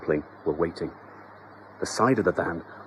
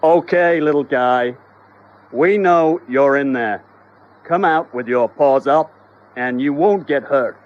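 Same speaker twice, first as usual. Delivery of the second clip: louder, like calling through a megaphone; loud hailer; 5 semitones higher, 8 dB louder than usual. Acted. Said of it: "switched on a loud hailer".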